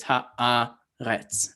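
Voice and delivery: ha-AA-retz, slow, syllable by syllable, weight on AA; ha'aretz drawn out with the accent on the middle AA; stress on middle syllable. Shown as a demonstration. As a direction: slowly break up ha'aretz, leaning on the second syllable. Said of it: "telling you which syllable you accent".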